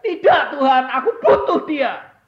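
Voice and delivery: high, loud desperate plea; pleading mother. Retold or imitated. Mother refusing God, loud desperate plea. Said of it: "Dia bilang".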